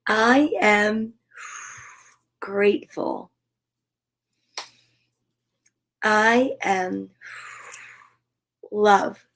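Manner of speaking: slow, spaced-out affirmation statements with long pauses. Acted. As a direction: let each word land after a pause.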